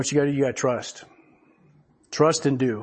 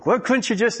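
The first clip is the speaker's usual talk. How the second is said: mock clenched-teeth talk, pitched higher; talking through clenched teeth; 8 semitones higher than usual. Shown as a demonstration. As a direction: squeeze the words through teeth held together. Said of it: "I'll talk like this".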